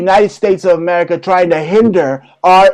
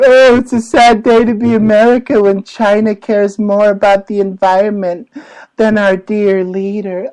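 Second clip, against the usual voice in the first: mocking whiny weenie; 4 semitones higher than usual. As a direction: sneer it out as a simpering weakling. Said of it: "all the weenies and wussies that are saying".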